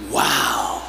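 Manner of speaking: a hint of a little boy's slow, soft awe; child's awe. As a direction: say it slowly and softly, wide-eyed like a small boy. Six-year-old son compliments mom.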